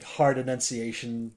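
usual speaking voice

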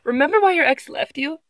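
mock accusing read. Shown as a demonstration. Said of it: "reading the article".

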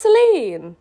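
sharp scolding call of her name; loud indignant snap; snapped. Acted. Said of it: "P B snapped".

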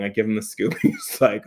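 usual speaking voice

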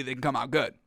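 normal talking voice

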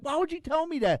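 high, loud, exasperated why; outraged protest. Retold or imitated. Husband retelling protest to partner, high exasperated. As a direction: demand it like a betrayed viewer.

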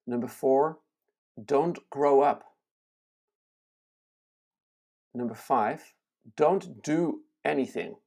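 slow, spaced-out list with long pauses; slow reading. Real, not acted. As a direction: read each item slowly and let it sink in. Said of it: "Once again, I'm gonna read it slowly".

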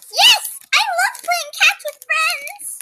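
high squeaky excited pig; excited Piggie voice. Acted. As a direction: bounce up high with joy. Kid voices Piggie in story.

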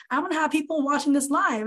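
usual speaking voice

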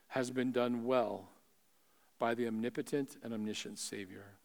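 soft, low, pastoral reassurance; hushed preaching. Real, not acted. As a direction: bring it down low and quiet, with pauses. Preacher's hushed closing appeal.

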